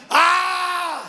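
high sudden battle cry "¡Ah!"; a bright shout showing how God cries out; demo war cry. Shown as a demonstration. Shout from verse "voceará así.

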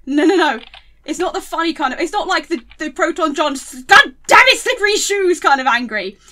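hammed-up frustration, yelling back at people; mock angry protest. Vlogger mock-ranting at people who call her rage funny. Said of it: "I'm like".

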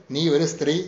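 ordinary talk, nothing marked

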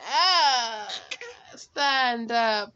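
loud laughing yelp; loud half-laughing ouch; startled cry. Real, not acted. Baby pulls at her, she cries out.

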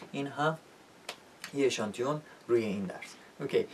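normal talking voice

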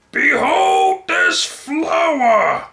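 very high, slow shout; proclaiming. Acted. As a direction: present it to the crowd grandly. Grand announcement to crowd.

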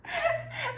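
a high burst of laughter; laughing. Real, not acted. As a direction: laugh off the stumble. Laughs at her own misreading.

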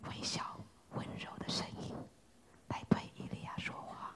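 hushed, unvoiced and gentle; soft whisper. Shown as a demonstration. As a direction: whisper it like God's still small voice. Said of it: "微小 温柔的声音". (温柔的耳语)

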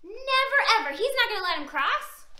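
ordinary delivery, nothing marked

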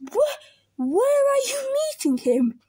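alarmed, voice leaping up; nervous fox. Acted. Fox asks mouse about the monster, nervous and high.

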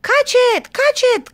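high, loud urgent cries; shrill-pitched storybook alarm; crying out. A narrator's reading. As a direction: shout like elves giving chase. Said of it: "cried the elves".

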